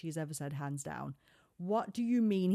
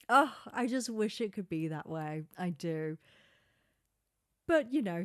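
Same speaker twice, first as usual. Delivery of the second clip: ordinary talk, nothing marked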